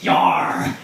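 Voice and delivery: loud growled pirate yar. Acted. Comedian voicing the pirate he greeted, pirate growl. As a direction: give a hearty pirate yar. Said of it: "he's like".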